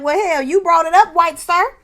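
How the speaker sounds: high, loud comic comeback; exaggerated disbelief, loud and high; mock indignant retort. Mock retort to a crying man.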